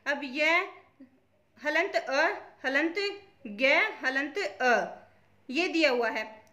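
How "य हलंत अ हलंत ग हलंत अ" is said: य, हलंत, अ, ग said apart with long pauses; sound-by-sound breakdown. Shown as a demonstration. Teacher splitting यज्ञ into its sounds, spelled out slowly. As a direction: slowly separate य and ग from each अ. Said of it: "ये वर्ण वियोजन पूछ रहा है".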